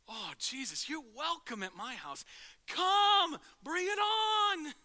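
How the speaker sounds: climbing to a loud, very high, slow shout; loud, high, overjoyed welcome; joyful welcome. Retold or imitated. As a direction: throw the door open with delight. Zacchaeus welcomes Jesus joyfully.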